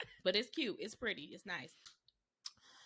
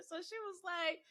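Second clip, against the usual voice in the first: ordinary talk, nothing marked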